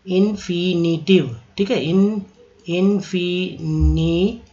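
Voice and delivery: teacher drawing out infinitive for students; slow syllable drill. Shown as a demonstration. Slowed teaching demo for the class. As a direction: stretch infinitive out slowly, syllable by syllable. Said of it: "कितना पढ़ेंगे? एक, दो, तीन, चार।".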